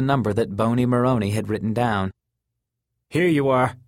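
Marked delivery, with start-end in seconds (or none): 3.1-3.8 impatient. Acted. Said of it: "the cabby said impatiently".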